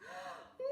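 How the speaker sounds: sharp high gasp of horror; horrified gasp. A narrator's reading. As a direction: gasp as if your baby is threatened. Mother sees baby threatened.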